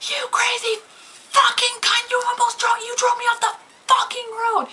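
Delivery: high, loud furious retold scream; screaming at her. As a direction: yell it at the van driver, pitch shooting way up. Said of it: "So I am screaming at this woman".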